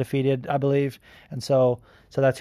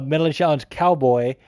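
ordinary delivery

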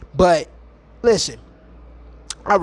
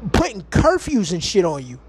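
ordinary talk

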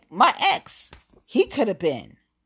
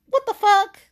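outraged disbelief; 8 dB louder than usual. Real, not acted. Outraged at cheating.

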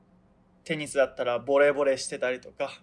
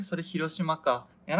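usual speaking voice

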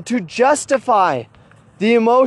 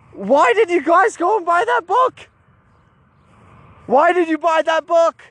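high, insistent retold challenge; mock-exasperated questioning; challenging question; 6 semitones higher than usual. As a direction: press the listeners for an answer, voice raised in pitch. Retold challenge to duped buyers.

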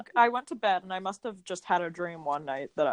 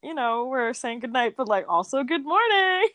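excited; 6 semitones higher than usual. Retold or imitated. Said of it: "we'd be like".

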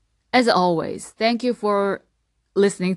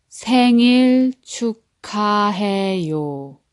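생일 축하해요 stretched out, every syllable drawn long; slow teacher model of 생일 축하해요; slowly; 3 semitones higher, 3 dB louder than usual. Shown as a demonstration. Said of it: "Now slowly, three times".

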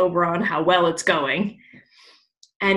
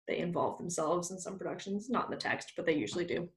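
much softer, half-whispered; softer, confiding aside; quiet aside; 12 dB quieter, faster than usual. Real, not acted. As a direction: drop into an aside.

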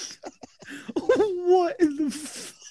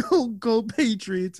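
ordinary talk

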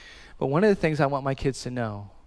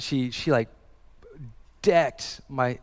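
ordinary talk, nothing marked

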